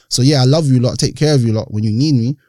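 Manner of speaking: ordinary talk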